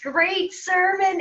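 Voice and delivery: high, slow, gushing compliment; beaming praise. Retold or imitated. Thrilled parishioner telling the priest great sermon, slow and high.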